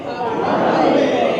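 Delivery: Harambe as ha-RAM-be, slow and clear; Harambe said clearly. Shown as a demonstration. Leader teaching the crowd the word Harambe, slow clear. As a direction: say Harambe slowly, each syllable. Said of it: "scream it in Swahili".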